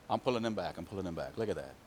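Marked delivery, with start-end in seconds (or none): none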